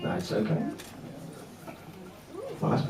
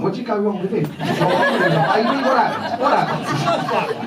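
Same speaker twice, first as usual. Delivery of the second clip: drawn out, higher mock disbelief; 8 semitones higher, slower than usual. Best man teasing the groom's parents, mock disbelief. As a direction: stretch it slowly in fake shock.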